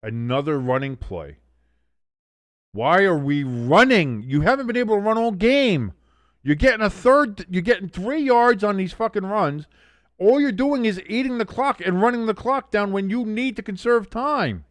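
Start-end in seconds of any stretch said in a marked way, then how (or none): 2.7-14.7 loud, high, exasperated tirade; angry rant. Real, not acted. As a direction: vent at the coaches, voice up high. Ranting at team's play calls.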